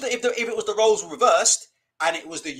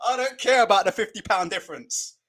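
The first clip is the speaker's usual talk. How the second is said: normal talking voice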